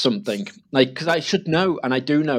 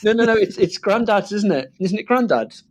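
put-on high, coaxing voice to a toddler; high pleading no-no-no; mock-pleading; 9 semitones higher than usual. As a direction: squeak it up like reasoning with a child. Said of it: "And I was like".

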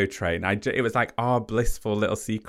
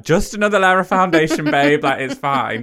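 breezy, higher, louder make-up voice; mock primping; 6 semitones higher, 7 dB louder than usual. To her impatient boyfriend, breezy mock primping voice. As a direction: airily brush off the rush, chirpy and loud. Her voicing herself applying makeup.

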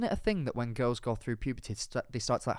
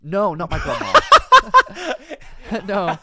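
loud laughing denial; 7 semitones higher, 13 dB louder than usual. Real, not acted. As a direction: laugh it off loudly. Laughing denial about grandma.

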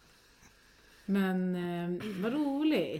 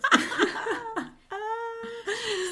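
high, tearful burst of laughter; helpless laugh; 3 dB louder than usual. Real, not acted. Friend laughing helplessly at the baby name Agüero. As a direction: laugh till it almost turns to crying.

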